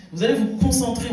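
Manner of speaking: usual speaking voice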